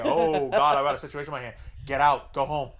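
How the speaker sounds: hammed-up frantic shout to leave; panicked order. Friend panicking, kicking him out.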